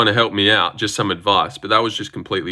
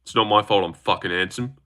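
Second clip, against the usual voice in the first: ordinary delivery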